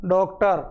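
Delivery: डॉक्टर with the open ऑ between आ and ओ; teacher demonstrating ऑ in डॉक्टर; ऑ vowel demo. Shown as a demonstration. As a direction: say डॉक्टर with ऑ, neither आ nor ओ. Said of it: "इसको कहेंगे ओ".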